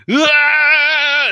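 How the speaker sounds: high, loud scream of panic; distant scream. Retold or imitated. GM voices the patrons screaming from Cheers, a high yell. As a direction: shriek like people dying far off. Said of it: "like, yelling".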